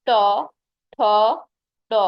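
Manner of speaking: ট ঠ ড said alone with the tongue curled back; teacher showing retroflex sounds ট ঠ ড; retroflex consonant demo. Shown as a demonstration. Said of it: "উচ্চারণ করো ট".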